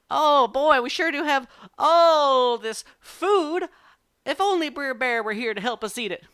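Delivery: high, loud party-goer; put-on party voice. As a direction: project it so the bear overhears. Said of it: "himself is doing these voices".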